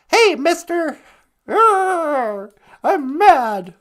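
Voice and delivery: hammed-up high, loud mock anger; pretend mad. Co-host shows how she would sound when mad at him, hamming it up high and loud. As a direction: growl out a cartoonish fake tantrum. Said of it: "How would you sound if you got really mad".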